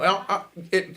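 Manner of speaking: ordinary delivery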